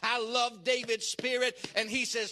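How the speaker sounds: usual speaking voice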